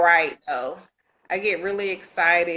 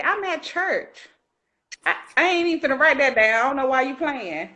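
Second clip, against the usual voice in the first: high scolding aside; 6 semitones higher than usual. Retold or imitated. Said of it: "I said".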